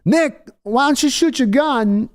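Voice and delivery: high, slow, oddly paced mock Walken; hammed-up Christopher Walken pleading; Walken impression. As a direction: draw it out like a jittery Walken. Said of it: "Walken's like".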